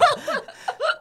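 sudden high laugh; high burst of laughter. Real, not acted. Laugh after mock quote.

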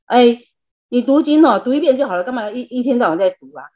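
playful impression of a grumbling husband; nagging husband. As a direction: imitate the husband brushing off her sutra reading. Husband scolding her for reading sutras.

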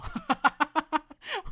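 loud rising laugh, high and bright; burst of laughter. Real, not acted. Retelling the rude masseur, bursting out laughing.

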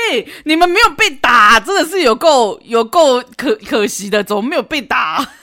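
loud, high laughing jeer; gleeful mockery. Real, not acted. Host laughing loudly that the boyfriend deserved a beating. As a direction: relish wishing he got hit.

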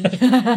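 short loud laugh; burst of laughter. Real, not acted. Laughing at joke.

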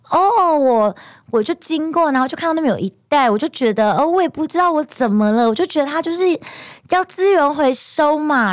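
high, mock-innocent imitation of mom pretending to remember; mom feigning recall. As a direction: play the mother faking a sudden memory, high and innocent. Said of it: "假装好像想起来说".

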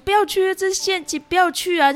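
panicked maid warning; frightened pleading. Acted. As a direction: beg him to stop, it's a trap. Maid begs boy not to enter.

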